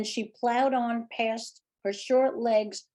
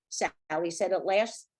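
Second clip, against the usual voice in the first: ordinary delivery, nothing marked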